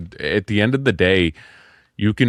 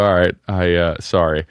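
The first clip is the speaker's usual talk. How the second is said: usual speaking voice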